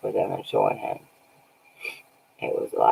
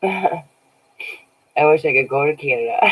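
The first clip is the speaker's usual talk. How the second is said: laughing; 4 semitones higher than usual. Real, not acted. Laughing on stream.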